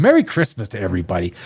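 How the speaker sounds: normal talking voice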